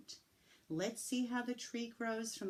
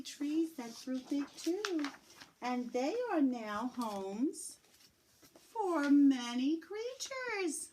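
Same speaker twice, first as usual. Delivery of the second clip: excited storytelling; 6 semitones higher, 5 dB louder than usual. Acted. Teacher telling children, building with excitement. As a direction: swell it up with wonder.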